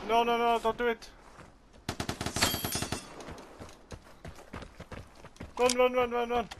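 high panicked pleading; urgent, high-pitched panic while fleeing; being chased. Real, not acted.

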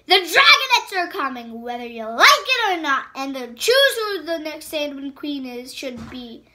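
loud defiant Ice Wing; defiant captive. Acted. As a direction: stand up to her. Captive defying the queen.

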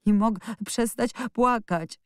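put-on boy crying that he can't stop, mild wail; wailing sobs. A narrator's reading. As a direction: plead through tears, understated. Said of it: "zawodził i szlochał".

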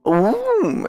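high delighted ooh; impressed ooh. Real, not acted. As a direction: let it rise up, pleasantly surprised. Spotting the nice track.

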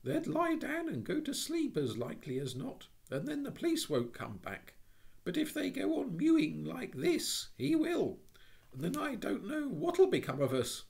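put-on high, loud, pleading child; an eager little girl persuading the burglar; eager persuasion. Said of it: "with eager persuasion".

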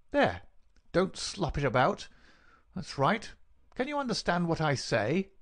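high, impatient visitor's voice; callous villain. Acted. Visitor gives dying man water, callously. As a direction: order him about with contempt.

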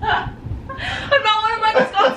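slow, high laughing words; laughing through words. Real, not acted. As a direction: crack up while talking. Laughing while repeating the joke.

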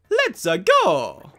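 loud, slow, drawn-out cheer; cartoon hero catchphrase. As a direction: crow it like a game hero. Mario's catchphrase as they set off.

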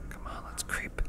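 hushed creeping whisper. Acted. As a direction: whisper it while sneaking. Sneaking in game.

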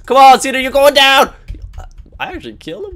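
normal talking voice

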